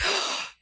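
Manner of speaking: quick breathless intake of shock; sharp gasp. Retold or imitated. Chef reenacting hearing a customer wants her, sharp gasp. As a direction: gasp like the news just landed.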